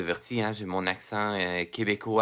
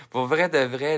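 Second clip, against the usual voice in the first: amused disbelief; 5 semitones higher, 6 dB louder than usual. Real, not acted. Laughing at the bad translator.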